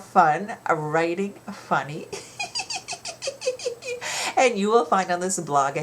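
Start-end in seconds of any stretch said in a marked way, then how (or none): 2.1-4.3 high breathy giggle; a bright little laugh at the end; light laugh. Real, not acted. Teacher signing off to students with a light laugh.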